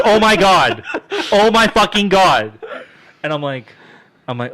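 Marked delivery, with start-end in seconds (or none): 0.1-2.5 high, loud, laughing retelling of a panicked cry; shocked cry. Retold or imitated. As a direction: cry out in disbelief, higher and louder. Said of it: "He goes".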